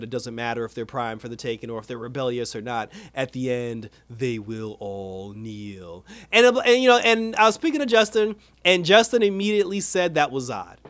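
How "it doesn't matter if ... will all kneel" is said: low, slowed-down villain threat; menacing general. Retold or imitated. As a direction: drag out the last words like a cold conqueror. Alien general threatens Earth.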